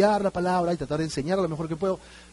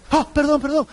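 higher, mock-nervous hasty apology; flustered apology; 8 semitones higher, 3 dB louder than usual. Mourner's visitor after saying the wrong thing, acted flustered apology. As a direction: blurt it out as if caught in a blunder. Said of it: "nos ponemos nerviosos".

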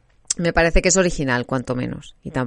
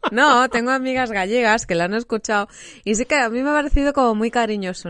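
laughing while talking; 6 semitones higher than usual. Real, not acted. Still laughing while explaining.

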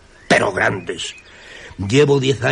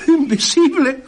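astonished disbelief; slower than usual. Acted. Told the speaker is invisible.

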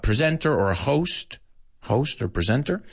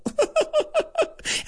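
high laugh. Real, not acted. Laughing at own speed.

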